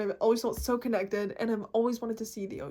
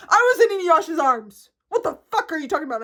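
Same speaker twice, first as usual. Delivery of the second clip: loud, indignant outburst; worked-up, exasperated rant; angry ranting; 5 semitones higher, 8 dB louder than usual. Real, not acted. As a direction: protest loudly, pitch raised. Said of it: "Me getting angry".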